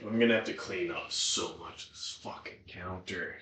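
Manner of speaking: ordinary talk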